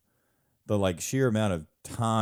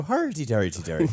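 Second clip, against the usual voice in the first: stock Irish lilt on hardy, hardy; a quick jokey Irish accent, pitched up; Irish accent tag thrown in for a laugh; Irish dialect; 3 semitones higher than usual. Retold or imitated. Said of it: "my end for an Irish dialect".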